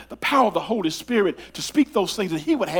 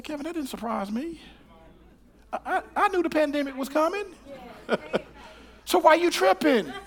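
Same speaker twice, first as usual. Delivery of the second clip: high, amused voice of God; God answering; 12 semitones higher than usual. Retold or imitated. God chiding the preacher for worrying. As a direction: gently tease a worried Kevin. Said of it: "He said".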